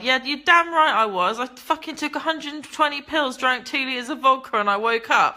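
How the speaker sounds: snapping back at viewers who judged her; defiant outburst. Real, not acted. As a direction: fire it back at the critics. Defiant outburst at viewers' angry reactions.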